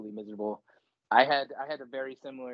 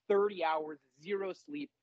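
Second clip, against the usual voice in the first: ordinary talk, nothing marked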